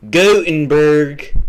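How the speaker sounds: "Gothenburg" blared slow and loud, GOE-in-burg; exaggerated pronunciation attempt. Shown as a demonstration. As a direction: stretch "Gothenburg" into a big, high GOE-n-burg. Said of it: "pronounce that in the right Swedish pronunciation".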